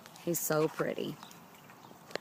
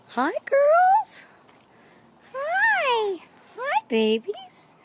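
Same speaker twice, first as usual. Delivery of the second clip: talking to pets; 10 dB louder, much slower than usual. Real, not acted. Greeting chickens, high sing-song.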